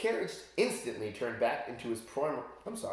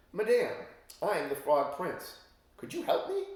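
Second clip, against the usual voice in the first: frog prince voice; 7 semitones higher, 3 dB louder than usual. Acted. Prince asks fairy for help.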